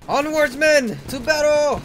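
loud high battle cry; rally the troops forward; rallying officer. Acted.

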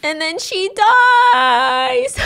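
high, drawn-out wailing; mock wail. Storyteller telling of grandma's death, mock wail. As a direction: stretch the last word into a high mock cry.

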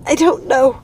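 high, strained gasping voice; choked, trembling. Acted. Gothel, held by the throat, trembling and short of air. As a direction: answer while being choked. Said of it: "trembling and grasping for air".

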